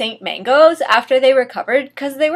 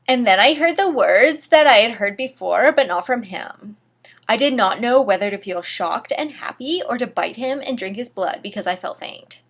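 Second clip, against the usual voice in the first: plain reading voice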